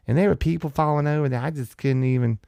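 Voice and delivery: high, quick, whiny Southern drawl; mock whiny drawl. Comic mocking her New York complaint, high whiny drawl. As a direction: whine it like she's scandalized. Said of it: "hers is just that whiny".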